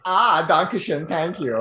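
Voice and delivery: drawn-out mock German thanks, near laughter; German accent. Fake tourist thanking a local, hammed-up German accent. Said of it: "I started speaking in a German accent".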